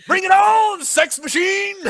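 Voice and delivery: very high, loud hammed-up shout; mock shout. Joining in on the movie quote, in a high shout. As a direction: belt it out like a B-movie hero.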